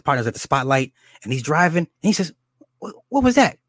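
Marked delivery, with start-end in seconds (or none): 2.8-3.6 high, startled cop asking what that was. Retold or imitated. Cop in the car asks his partner, high and startled. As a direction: jump up high, spooked by a noise in the dark.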